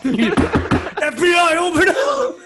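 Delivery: slow, loud and high laughing police shout; grave federal agent. Pretend. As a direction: bang on the door as a laughing agent. Mock federal agent raid.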